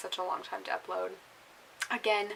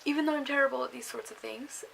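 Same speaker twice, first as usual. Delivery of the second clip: ordinary talk, nothing marked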